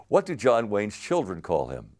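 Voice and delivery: ordinary delivery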